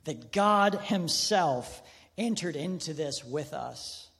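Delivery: ordinary talk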